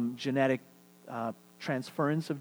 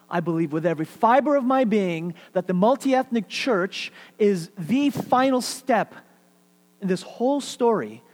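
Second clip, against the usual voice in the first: emphatic preaching build; 5 semitones higher, 7 dB louder than usual. Real, not acted. Preacher to congregation, building to a loud climax. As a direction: preach it louder, slowing on the final step.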